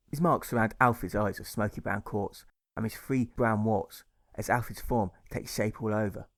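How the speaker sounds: ordinary delivery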